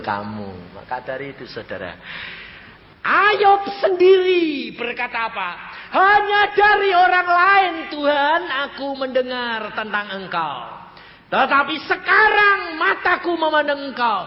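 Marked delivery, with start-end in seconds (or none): 3.0-14.2 high, loud declamation of scripture; ringing high pulpit cry; full-force preaching. Acted. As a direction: proclaim Job's words to the whole congregation.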